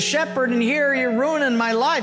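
put-on a young man at the end of his rope, pitched high; exasperated complaint. Shepherd argues with God over giving up his sandals, high agitated protest.

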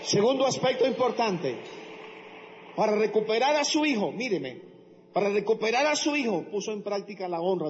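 proclamación muy fuerte y aguda; predicación fervorosa. Acted. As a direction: arengar a la congregación a gritos. Predica con fuerza de nuevo. (predicación a todo pulmón)

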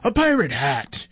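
a reader's understated storybook roar; mock roar. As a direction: exclaim it high, as a pirate in a picture book. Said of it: "roared Backbeard".

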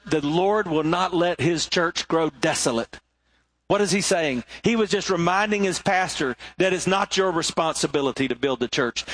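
ordinary talk

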